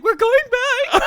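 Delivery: put-on high, slow, loud upset child; mortified kid. Retelling the son's dismay in a high loud child voice. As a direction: whine it like a dismayed boy. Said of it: "Cooper was mortified".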